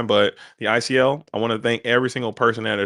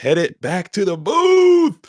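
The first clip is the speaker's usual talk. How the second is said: calling out; 9 semitones higher, 7 dB louder, much slower than usual. Real, not acted. Host sends show back, calls out.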